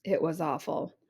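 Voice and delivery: ordinary delivery, nothing marked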